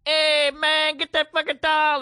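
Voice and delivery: high, loud mock Chicano accent. Cohost guessing at the locker-room guys, Chicano accent. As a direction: put on a loud Chicano tough-guy accent.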